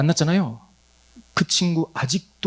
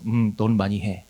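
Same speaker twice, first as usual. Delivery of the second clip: mock inner reply; 7 semitones lower, slower than usual. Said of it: "제가 마음속으로 얘기했어요".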